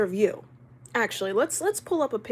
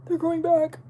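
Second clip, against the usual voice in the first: high, louder put-on squeak; playful high voice; 8 semitones higher, 4 dB louder than usual. Streamer insisting on regrowing eyebrows in a silly high voice. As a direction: pitch it way up, playfully.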